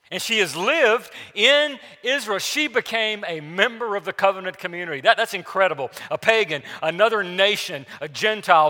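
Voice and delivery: loud, driving sermon voice; fiery preaching. Acted. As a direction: preach it hard over the congregation. Preaching at full force.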